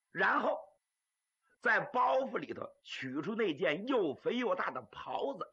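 usual speaking voice

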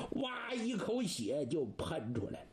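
usual speaking voice

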